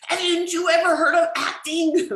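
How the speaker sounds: high, loud, indignant imitation; mock-furious scolding; exasperated retold yell. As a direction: yell it as an outraged actor's put-down. Retold furious actor scolding her.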